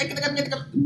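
high, quiet stammer; stammering broken Dutch. Retold or imitated. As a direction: stutter like the delivery guy. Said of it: "Broer, ik versta jou niet". (hoog, zacht gestotter)

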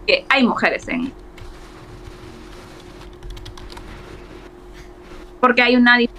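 choppy, cutting out mid-word; breaking up on a bad connection; call dropping out. Real, not acted. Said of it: "creo que te estás cortando un poquito". (llamada entrecortada)

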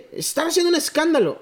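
vecino quejumbroso con voz aguda; modito golpeado. Retold or imitated. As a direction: pide la patrulla con tono exigente. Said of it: "tenía moditos golpeados". (el vecino pidiendo patrulla, con modito golpeado)

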